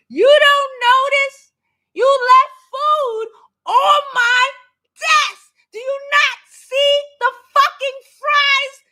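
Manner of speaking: near-shouting rant at the mess; exasperated yelling. Retold or imitated. Ranting about food left on desk.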